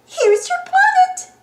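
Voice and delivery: very high, slow singsong sweetness; sugary high mouse voice; sweetly. A narrator's reading. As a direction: coo it high and drawn out. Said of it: "Minnie says sweetly".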